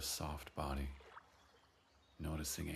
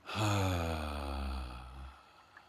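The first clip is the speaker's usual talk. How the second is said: long audible sigh out; voiced sigh; 3 semitones lower, 5 dB louder than usual. Shown as a demonstration. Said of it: "feel your voice in your body as you sigh".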